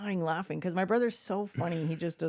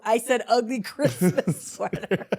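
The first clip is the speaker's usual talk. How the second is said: louder, slow, laughing through it; laughing correction; 4 semitones higher, 5 dB louder, slower than usual. Retold or imitated. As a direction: correct him while cracking up. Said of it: "And I go".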